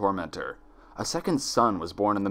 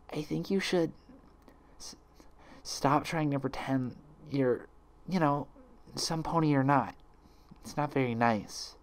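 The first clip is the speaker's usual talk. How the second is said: timid soft voice; 4 semitones higher, 5 dB quieter than usual. Acted. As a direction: say it gently, afraid to hurt. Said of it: "she whispered softly".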